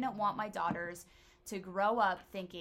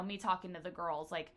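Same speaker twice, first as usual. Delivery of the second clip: ordinary delivery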